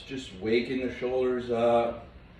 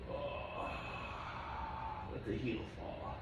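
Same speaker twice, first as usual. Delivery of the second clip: strained whisper; 15 dB quieter than usual. Real, not acted. Quiet cues during a hard pose.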